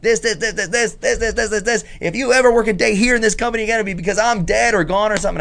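high, rapid-fire ranting imitation; Jim Cornette. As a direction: stammer into a high, fast tirade. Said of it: "the way he said it was not a joke".

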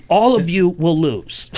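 slow, pointed teacher warning; a touch of a schoolteacher scolding the class; stern teacher. Retold or imitated. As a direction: lay down the rule like a schoolteacher. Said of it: "went third grade school teacher on".

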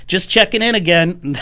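pesky follow-up. Retold or imitated. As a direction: chirp it like an annoying reminder. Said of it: "I was just like".

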